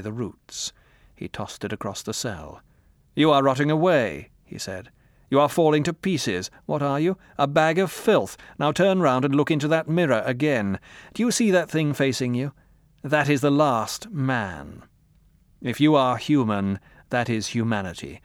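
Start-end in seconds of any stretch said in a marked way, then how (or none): 3.1-4.3 louder, higher scornful interrogator; O'Brien, scornful. Acted. O'Brien condemns Winston. 5.3-18.2 louder, higher scornful interrogator; O'Brien, scornful. Acted. O'Brien shows Winston the mirror.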